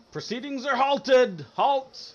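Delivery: shouted like an official stopping the games; booming shout. Acted. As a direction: bellow the order across the arena. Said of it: "the guy kind of shouts in the, a very kind of large, like, baritone voice".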